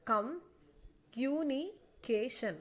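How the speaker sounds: "Communication" said slowly, each syllable apart. Shown as a demonstration. Teacher showing students the syllables, slowly. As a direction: break "communication" into slow beats. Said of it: "communication എത്രയുണ്ട്?".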